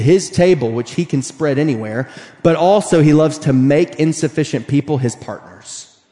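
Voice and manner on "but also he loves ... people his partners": normal talking voice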